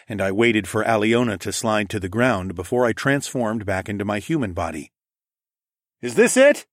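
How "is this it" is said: high, loud call over howling wind. A narrator's reading. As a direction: shout it across the gale. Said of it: "I called out over the howling wind".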